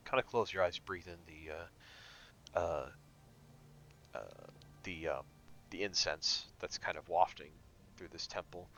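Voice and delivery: soft, low guided meditation; calm meditation guide. Acted. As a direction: ease them into calm.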